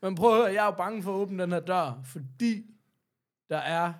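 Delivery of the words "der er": ordinary delivery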